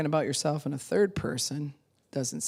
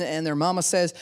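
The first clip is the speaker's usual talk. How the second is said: ordinary delivery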